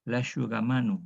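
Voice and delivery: "L'asciugamano" with sc as sh, lashugamano; Italian sh example. Shown as a demonstration. Teacher gives "L'asciugamano" as an Italian sh example. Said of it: "la sh del italiano".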